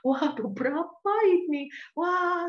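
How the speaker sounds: high amazed exclamation. Retold or imitated. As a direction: gasp at how big the sum is. Amazed at the total. (terkejut dengan nada tinggi)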